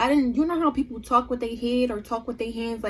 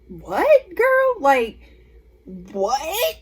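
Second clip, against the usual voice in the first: incredulous; 4 dB louder, much slower than usual. Retold or imitated. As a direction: act out total disbelief, breaking into a laugh. Acts out disbelief at accusation.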